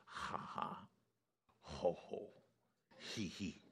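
quiet, spaced-out forced laughter; soft, airy mock chuckles, syllable by syllable; deliberate imitated laugh. Preacher shows how his teacher started laughing, deliberate imitated laugh. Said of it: "remember how he started, he's like".

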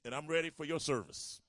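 preacher leading the crowd in a shouted vow; fervent prayer. Acted. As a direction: call it out to God with everything.

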